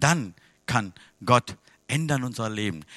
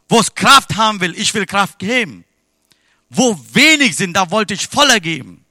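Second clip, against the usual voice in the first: louder, higher proclaiming praise; full-force preaching; 6 semitones higher, 9 dB louder than usual. Acted. Preacher proclaims God's miracles to the church, full force. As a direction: ring it out to the congregation.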